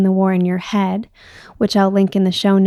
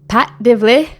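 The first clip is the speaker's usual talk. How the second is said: bad Irish accent attempt; 3 semitones higher, 4 dB louder than usual. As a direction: say the name in a hammy Irish accent. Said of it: "one of the worst Irish accents I've ever heard".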